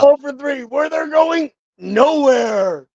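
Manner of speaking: high, scoffing mockery; mocking. Real, not acted. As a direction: sneer at their failures.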